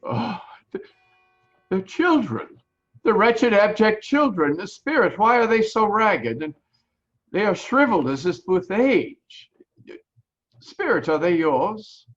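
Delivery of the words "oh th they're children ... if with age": horrified. Acted. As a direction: recoil in horror at the wretched children. Scrooge sees ragged children.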